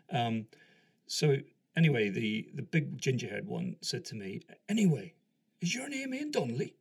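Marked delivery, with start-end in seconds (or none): 4.7-6.7 high-pitched, pointed query from a sergeant; questioning sergeant. Retold or imitated. Sergeant checks the visitor's name, questioning him. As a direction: put the question loudly, then pitch it high.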